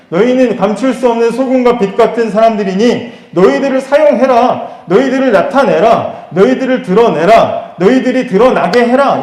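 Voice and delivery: forceful repeated charge, high and loud; preached command of Jesus. Acted. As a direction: hammer out each command to the congregation. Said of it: "예수님 지금 그 말씀하시는 거예요".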